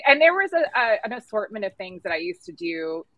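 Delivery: ordinary talk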